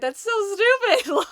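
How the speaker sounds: high, drawn-out incredulous reaction; exasperated disbelief. Retold or imitated. Friend reacting to the mother's story, exasperated disbelief. Listener voices indignant reaction.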